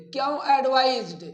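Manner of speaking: ordinary delivery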